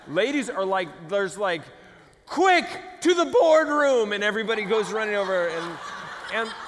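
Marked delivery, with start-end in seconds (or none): 2.3-4.1 high, loud, drawn-out summons; mock rallying call. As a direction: call everyone to action like a cartoon hero. Mock urgent call to gather.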